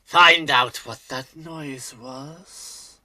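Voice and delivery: slow, loud, sultry voice; sultry perfume ad. Acted. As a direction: purr it out slowly like a perfume ad. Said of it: "a very sultry voice".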